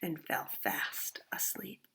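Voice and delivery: slow, sleepy whisper. A narrator's reading. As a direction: let it drift off into sleep. Narrator drifting into sleep.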